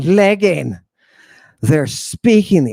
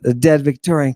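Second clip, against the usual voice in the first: ordinary talk, nothing marked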